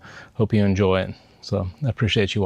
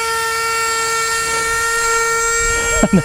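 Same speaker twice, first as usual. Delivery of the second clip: long real laugh. Real, not acted. Laughs after the failed launch.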